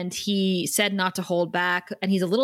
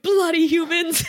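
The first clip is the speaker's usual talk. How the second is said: higher, louder British scoff; grumbling Giles; 8 semitones higher, 4 dB louder than usual. Retold or imitated. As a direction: sneer at the humans. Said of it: "Giles is like".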